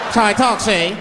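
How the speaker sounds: lightly voiced old-movie gangster; forties bad guy. Defending his crazy talk, mock forties gangster. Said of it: "that's how bad guys used to talk in the forties".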